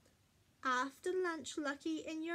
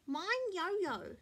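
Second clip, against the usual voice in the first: put-on high, swooping little-child voice; declaring toddler; 5 semitones higher, 4 dB louder than usual. A narrator's reading. Baby Louie names the other kitten, toddler declaring. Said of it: "baby Louie declared".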